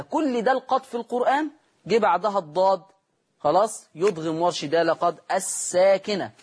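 normal talking voice

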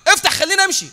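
loud, fast, demanding, pitch rising; pushy beggar. Retold or imitated. Beggar barging in on the rich merchant, loud rude demand. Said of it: "يقول له".

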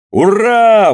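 high, loud triumphant cheer. Acted. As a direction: roar hurrah in triumph. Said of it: "воскликнул экипаж".

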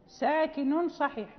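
usual speaking voice

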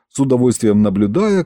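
ordinary delivery

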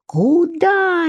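ordinary delivery, nothing marked

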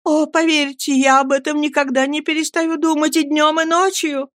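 lightly voiced tearful cry; anguished cry. A narrator's reading. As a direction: swear she never stops grieving. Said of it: "крикнула Эмилия".

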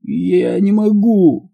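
long, high, pained groan; moaning. A narrator's reading. Injured Mike unable to open his eyes, moaning. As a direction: draw it out slowly as a high moan. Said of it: "простонал Майк".

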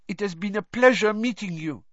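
put-on high, light French-accented voice. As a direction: speak like a French girl. Fleur speaking.

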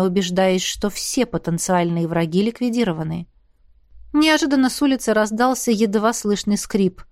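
ordinary delivery

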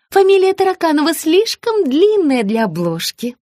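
high-pitched explaining; high sugary woman. A narrator's reading. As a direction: explain sweetly. Margarita's voice.

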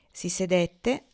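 ordinary delivery, nothing marked

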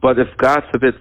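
ordinary talk

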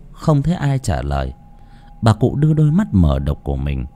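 ordinary delivery, nothing marked

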